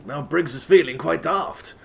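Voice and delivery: normal talking voice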